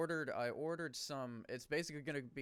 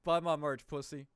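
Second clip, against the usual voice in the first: loud, higher joking trash talk; hard-sell it with playful abuse; mock aggressive pitch; 4 semitones higher, 8 dB louder than usual. Podcaster jokingly bullying listeners into buying merch, mock aggressive pitch.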